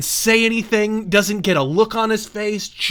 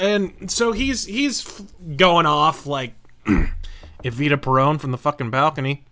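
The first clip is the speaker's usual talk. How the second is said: usual speaking voice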